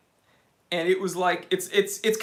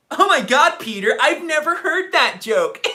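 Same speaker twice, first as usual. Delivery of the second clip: laughing out the words at a joke; cracking up while talking; 6 semitones higher, 7 dB louder than usual. Real, not acted.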